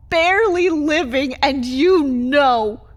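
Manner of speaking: loud, slowed, accusing; bitter outburst. Acted. Vampire accusing her partner of knowing her pain. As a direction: lean on each word, accusing.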